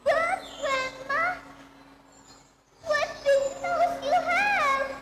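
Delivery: put-on high, bright child voice, slow on the question; wide-eyed little girl asking grandma.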